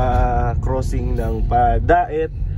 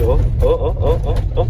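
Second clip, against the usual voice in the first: on a bumpy road; 2 semitones higher than usual. Real, not acted. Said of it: "aalog-alog ka talaga dito".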